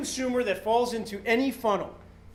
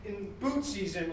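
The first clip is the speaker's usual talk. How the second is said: ordinary talk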